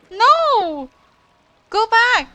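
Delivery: usual speaking voice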